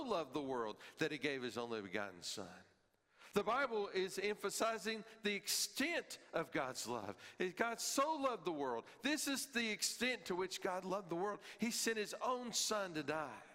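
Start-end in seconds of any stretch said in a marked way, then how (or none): 3.3-13.4 much higher, louder preaching; full-force sermon peak; impassioned preaching. Acted. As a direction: press the extent of God's love on the listeners.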